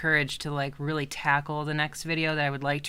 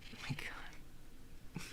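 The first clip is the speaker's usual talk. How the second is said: slow, very soft whisper; 19 dB quieter, much slower than usual. Real, not acted. Streamer whispering over her cute cat, soft and slow. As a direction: breathe it out, overwhelmed by cuteness. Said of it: "whispered over cute cat".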